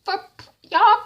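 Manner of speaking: very high, sassy incredulous voice; incredulous rant. Real, not acted. Viewer reacting to Carissa asking why pray, high sassy. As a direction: call her out, dripping disbelief. Speaker's own mock reaction.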